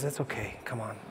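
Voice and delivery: barely spoken, kind welcome; soft reassurance. Acted. Jesus welcomes the tired crowd, soft gentle reassurance. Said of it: "Jesus says".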